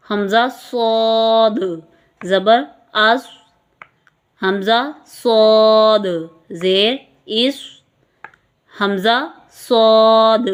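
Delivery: صاد held long, the final دال bouncing with an echoing jolt; teacher showing qalqalah on the final دال of صاد. Shown as a demonstration. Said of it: "صاد کے اینڈ پر دال کو قلقلہ".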